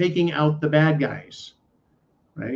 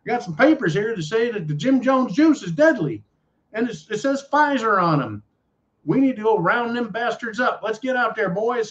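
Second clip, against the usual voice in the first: put-on hillbilly Southern accent; 6 semitones higher than usual. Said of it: "my favorite hillbilly Southern hick, uh, accent".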